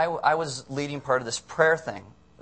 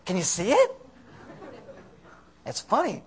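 ordinary talk

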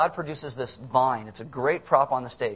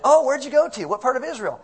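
eager curiosity; 9 semitones higher, 6 dB louder than usual. Retold or imitated. Acted homecoming chat, eager neighbours.

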